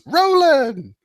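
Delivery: high-pitched cheering shout; crowd yell. Acted. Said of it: "the entire crowd yells".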